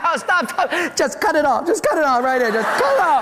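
high, laughing mock begging; boy begging the nurse to stop scrubbing, high and laughing; pleading. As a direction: beg her to stop, half laughing.

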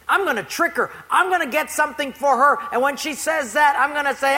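lightly voiced schemer working up a trick; scheming neighbor. Atheist neighbor planning to trick the lady, mildly scheming.

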